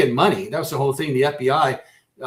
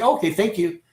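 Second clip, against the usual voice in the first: mock cheerful thanks; 6 semitones higher, 6 dB louder than usual. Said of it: "They're not gonna say".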